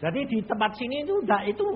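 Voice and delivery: normal talking voice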